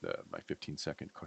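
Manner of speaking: normal talking voice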